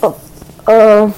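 usual speaking voice

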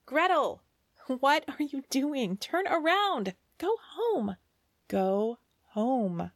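urgent calling. Acted. Birds warn Gretel urgently.

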